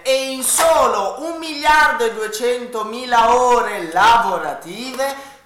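slow, spelled-out phone number; infomercial. Acted. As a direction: read the number like a TV ad.

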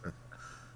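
quiet, breath-only chuckle; low-key self-satisfied chuckle; soft chuckle. A narrator's reading. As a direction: chuckle briefly under the breath. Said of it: "The general chuckled".

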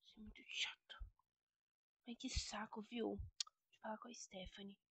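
hushed complaint so mum won't hear; whispered grumble. Acted. Daughter grumbling aside behind mother's back, whispered. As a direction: whisper under your breath.

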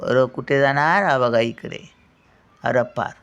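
normal talking voice

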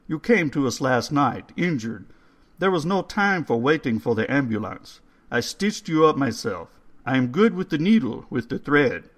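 put-on coaxing old man spooning soup. A narrator's reading. Old man's voice, held.

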